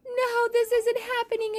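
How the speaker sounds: high, loud wail of dismay; dismayed cry. Acted. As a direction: cry out as the trap springs wrong. Net falls on Olivia.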